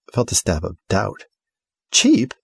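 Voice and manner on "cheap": high, louder stung echo; hurt disbelief. Acted. House repeats the insult, high stung disbelief. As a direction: catch on the word in shock.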